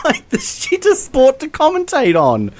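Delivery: high, slow, giggly jab; laughing scorn. Real, not acted. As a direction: drag it out through a laugh. Laughing while mocking shooting.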